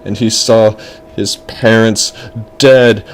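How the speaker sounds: ordinary delivery, nothing marked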